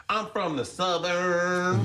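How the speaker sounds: slowed, high teasing Southern voice; mock Southern accent. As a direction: kids mocking a thick Georgia accent. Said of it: "they'd be like".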